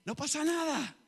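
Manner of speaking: relieved calm reply. Pretend. Believers voicing untroubled relief at prophecy fulfilled. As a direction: sound unbothered, it's all expected.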